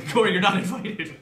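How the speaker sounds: loud, laughing mock scolding; laughing shout. Real, not acted. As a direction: shoo him off through laughter. Laughing at intruder.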